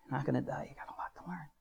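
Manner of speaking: very soft, near whisper; haunting recalled words. Retold or imitated. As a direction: murmur it like words stuck in your head. Words gnawing at him, recalled.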